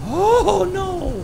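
dread-filled high cry; dismayed wail. Real, not acted. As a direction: draw it out high, then let it fade. Dread on losing candle.

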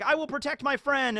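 high youthful heroic voice; Kobe heroic. Retold or imitated. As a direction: pitch way up and swear to protect a friend. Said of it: "Kobe's like".

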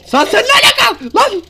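high, loud and drawn-out cry of surprise; startled shout. Real, not acted. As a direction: yell in shock as someone pops up. Opponent suddenly appears, startled shout.

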